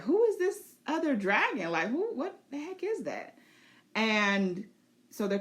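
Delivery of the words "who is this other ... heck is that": high, startled question; high alarmed wonder. Pretend. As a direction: spot an unknown dragon overhead. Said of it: "Bela is looking up like".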